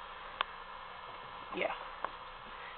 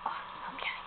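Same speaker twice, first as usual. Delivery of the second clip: whispering; 14 dB quieter than usual. Real, not acted.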